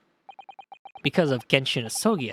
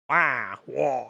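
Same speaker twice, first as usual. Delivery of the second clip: Waluigi wah; 4 semitones lower than usual. Acted. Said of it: "He's, like, deeper".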